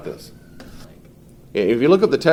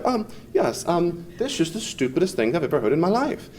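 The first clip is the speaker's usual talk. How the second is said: higher-pitched mock-clever British scholar; attempted British accent; 7 semitones higher than usual. Teacher mimicking a condescending British atheist, attempted British accent. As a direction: lightly imitate a condescending British academic. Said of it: "I can't do a British accent".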